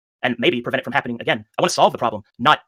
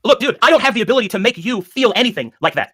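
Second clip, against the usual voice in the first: heated, higher-pitched protest; real exasperated raised voice; 8 semitones higher, 3 dB louder than usual. Real, not acted. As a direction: argue back with your voice rising. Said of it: "I, I raised my voice".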